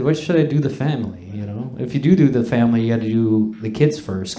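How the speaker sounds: ordinary talk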